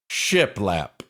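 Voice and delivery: usual speaking voice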